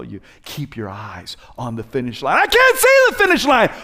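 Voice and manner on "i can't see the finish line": much higher, loud mock wail; whiny complaint. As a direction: wail it like a quitter. Imitates despairing listener.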